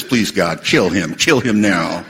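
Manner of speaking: low, intense plea with menace; angry mock prayer. Angry person trying to pray to God, low and vengeful. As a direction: pray darkly through your anger, pitched low. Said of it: "It'll sound like this".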